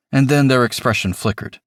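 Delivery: usual speaking voice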